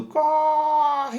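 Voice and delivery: long, high mock call without full volume; imitated arriving shout. The warning reaching the worker's ear, high drawn-out mock yell. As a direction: stretch it out like a cry reaching someone's ear. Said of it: "esse som vai chegar no ouvido dele dizendo".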